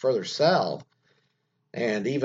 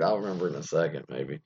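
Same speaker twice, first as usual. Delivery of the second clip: ordinary talk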